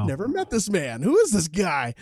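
high, loud, comic bafflement; mock disbelief. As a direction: exclaim it, hammed-up and incredulous. Retold astonishment at the pastor's words.

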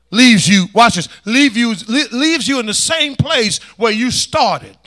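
loud, punched repeats; forceful preaching. Real, not acted. Preacher on the dry season of life, forceful preaching. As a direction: drive each phrase home to the congregation.

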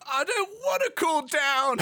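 high-pitched tantrum protest; petulant, high-pitched outburst; upset outburst. Acted. Garrett refuses to calm down.

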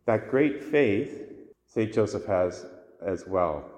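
usual speaking voice

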